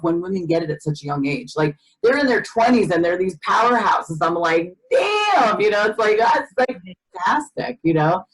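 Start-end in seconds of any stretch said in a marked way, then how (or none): 4.9-7.8 impressed damn. Retold or imitated. Telling of young powerhouse women, high impressed damn. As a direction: stretch it high in amazement. Admiring reaction, retold.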